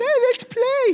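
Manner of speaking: put-on squeaky high baby voice; chirpy baby. Tiny baby chirping at tired parent, high and eager.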